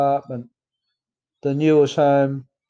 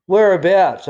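urgent question; 6 semitones higher, 7 dB louder than usual. Retold or imitated. Pressing Dad for the car yard.

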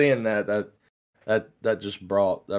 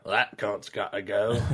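Butcher impression. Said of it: "sounds like Butcher from The Boys".

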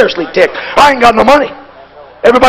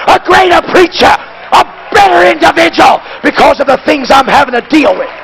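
rising, shouted sermon climax; full-force preaching; 8 semitones higher than usual. Acted. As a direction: drive it home to the congregation.

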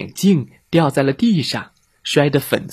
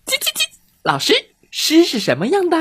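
very high, fast chick squeak; faster than usual. Acted. Chicks pestering the dog teacher, fast high squeak.

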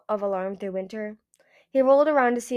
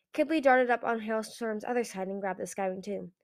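ordinary delivery, nothing marked

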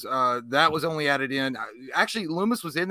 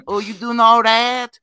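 much higher, louder and slow cooing; baby talk voice; 9 semitones higher, 9 dB louder, slower than usual. Pretend. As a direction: coo at a toddler, big and high and drawn out. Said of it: "I'm like".

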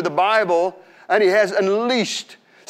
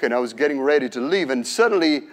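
ordinary delivery

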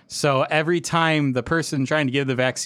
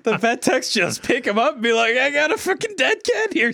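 high, laughing retelling; 10 semitones higher than usual. Real, not acted. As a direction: tell it through real laughter. Laughing while quoting vet techs.

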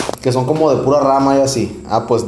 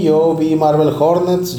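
ordinary talk, nothing marked

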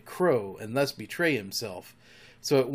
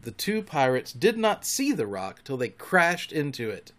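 plain reading voice

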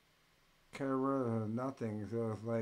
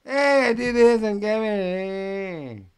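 much higher, much louder put-on yelling voice; hammed-up caricature of an eager guy; mocking the other guy; 10 semitones higher, 16 dB louder, slower than usual. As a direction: shout it like an excited nobody begging for attention. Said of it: "and the guy".

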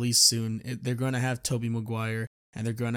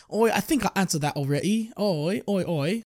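higher, hammed-up British accent; mock British accent; 7 semitones higher, 3 dB louder than usual. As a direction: play the cheeky British bloke brushing off the question. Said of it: "This accent was, uh, irresponsible and offensive".

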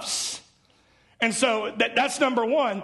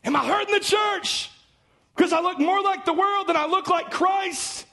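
high, ringing preacher's cry; preaching shout; 9 semitones higher than usual. Real, not acted. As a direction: fling the question out to the congregation. Preacher's fervent climax.

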